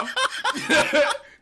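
big high burst of laughter; howling laugh while watching the video; loud laugh. Real, not acted. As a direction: crack up loudly at the clip.

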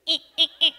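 high loud eh calls; bright high eh sounds; high forward eh, eh, eh; nasal eh. Shown as a demonstration. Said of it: "And then go".

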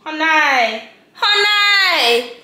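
loud, high sing-song call. Real, not acted. Greeting her viewers, calling out loud. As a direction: call across the room.

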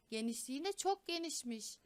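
ordinary delivery

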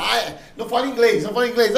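loud, high-pitched mocking clamour; mock online critics. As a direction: imitate a pile-on of haters. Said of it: "começaram a falar".

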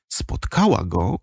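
ordinary talk

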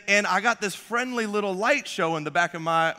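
ordinary talk

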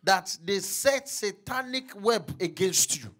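shouted preaching, loud and high; full-force preaching. Acted. Pastor charging the congregation, preaching at full force. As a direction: thunder it out like a revival preacher.

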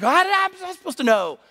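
put-on very high, whiny kid defense; exasperated protest. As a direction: imitate a teenager wailing in self-defense. Boy protests to his mother.

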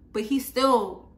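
ordinary talk

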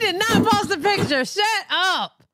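high, fast exclamation through laughter; real shocked squawk, laughing; excited disbelief. Real, not acted. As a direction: burst out in giddy disbelief. Disbelief at news of baby photo.

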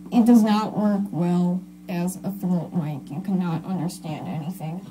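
garbled contact mic pickup; muffled, distorted through a throat mic. Real, not acted. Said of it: "that was really hard to understand".

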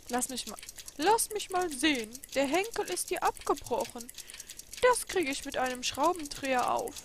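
high, loud, matter-of-fact; tinkering craftsman talking to himself; busy old clockmaker. Acted. Clockmaker inspects the broken alarm clock, high and busy.

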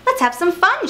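high, playful clown call; gleeful shout. A narrator's reading. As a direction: invite everyone to play, excitedly. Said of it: "shouted the clowns".

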